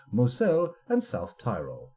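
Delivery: ordinary talk, nothing marked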